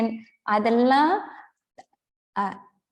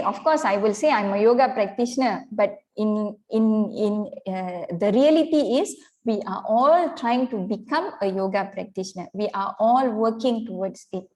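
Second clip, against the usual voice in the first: ordinary talk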